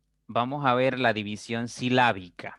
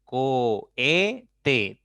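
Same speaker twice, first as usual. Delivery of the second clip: slow "Cohete", every syllable stretched and separated; "Cohete" in three long beats; 3 dB louder than usual. Shown as a demonstration. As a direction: sound out "Cohete" as Co, he, te. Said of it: "Tiene tres sílabas".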